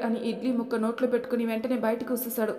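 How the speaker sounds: ordinary delivery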